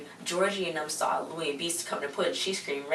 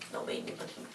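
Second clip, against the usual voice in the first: a breathy whisper, much softer; whispered aside; 13 dB quieter, much faster than usual. Real, not acted. Viewer grossed out by the pudding bath, whispering. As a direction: repeat it to yourself under the breath. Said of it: "repeated hushed".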